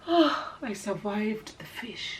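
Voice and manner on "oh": ordinary talk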